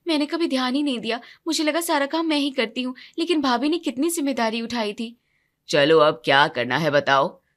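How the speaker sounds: usual speaking voice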